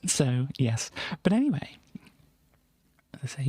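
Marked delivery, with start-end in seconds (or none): 1.2-3.5 fast unvoiced whisper; whispered aside. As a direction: rush it under your breath.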